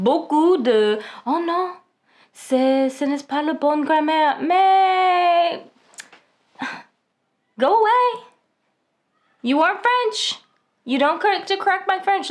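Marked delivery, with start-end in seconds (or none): 1.2-5.6 high-pitched, exaggerated imitation of a nitpicker; mock grammar correction. American correcting the speaker's French grammar, mock nitpicking. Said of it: "les Américains aiment beaucoup de corriger". 7.6-12.3 high-pitched, exasperated telling-off; mock indignation. Speaker telling non-French critics to stop correcting, mock indignant.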